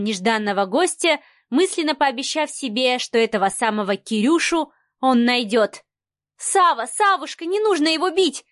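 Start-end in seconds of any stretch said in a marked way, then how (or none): 6.4-8.4 higher, pleading chatter; rapid chatter. A narrator's reading. Marianna pleading with Sava not to fight, rapid chatter. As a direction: jabber it out in a higher voice. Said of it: "тараторила Марианна".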